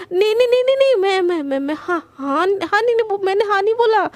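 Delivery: high, loud stammering panic; flustered denial. Acted. Priyanka, flustered, takes back her yes to Garima, high and loud. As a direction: trip over the words in a fluster.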